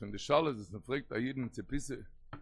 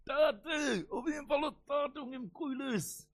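high-pitched cry of a daughter to her father; imitated desperate scream; 11 semitones higher than usual. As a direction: act out a pleading scream, pitched far up. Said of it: "און זי גייט מיר שרייט".

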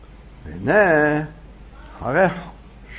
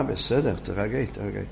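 calming; 9 semitones lower, 8 dB quieter than usual. Acted. Rabbi calms shouting woman.

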